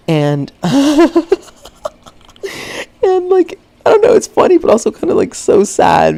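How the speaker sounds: high, loud, laughing through the words; squeaky voice breaking into laughter; cracking up. Real, not acted. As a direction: talk while helpless with laughter. Cracks up retelling the prank.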